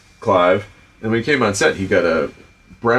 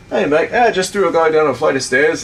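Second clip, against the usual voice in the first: higher-pitched chummy British impression; Clive's British accent; 7 semitones higher, 4 dB louder than usual. Imitating actor chatting from movie trailer, British accent. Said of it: "I don't wanna do a bad Clive impersonation".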